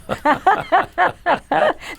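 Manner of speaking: a high, rising burst of laughter; real laugh. Real, not acted. Laughing at her own joke about the accent, high. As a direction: crack up at your own joke.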